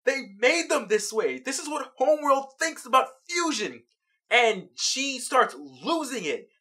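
usual speaking voice

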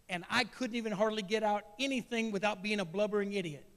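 usual speaking voice

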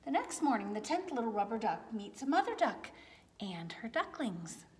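gentle storybook narration, lots of tune; reading to children. A narrator's reading. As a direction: read it like bedtime to little ones. Reading a picture book aloud.

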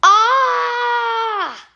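sudden high shocked yelp; shocked little shout; startled cry. Acted. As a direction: jump at the sight of the mess. Character startled by snacking reader.

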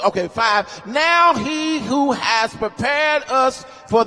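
sermon cadence, rising and intense; preaching. Acted. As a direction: lift the scripture like a preacher at the pulpit. Preaching scripture.

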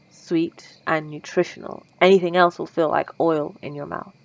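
ordinary talk, nothing marked

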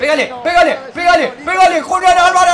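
high, loud repeated shouts mounting to a yell; excited urging. Real, not acted. Streamer urging a player to shoot, frantic shouting.

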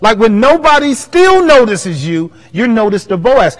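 ordinary talk, nothing marked

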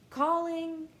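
ordinary delivery

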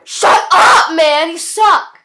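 loud, high-pitched shouted heckle; angry heckle. Acted. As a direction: yell it to shut the host down. Heckling the host in skit.

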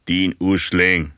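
slow, loud ogre-like elder; a terrible ancient roaring; old man shouting. A narrator's reading. Huge old man roars insults at the boy, slow and loud. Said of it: "råbte han".

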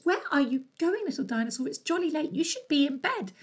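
high cheerful snowman voice. Acted. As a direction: pitch it up like a jolly snowman.

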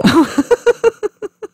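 very high wheezy laugh; helpless laughter. Real, not acted. Performer laughs at their own poor act, helpless laughter. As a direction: burst into breathless laughing. Cracks up again.

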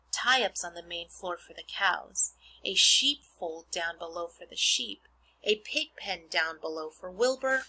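put-on expressive read-aloud to children; reading a book to a class; story narration. Reads Charlotte's Web aloud.

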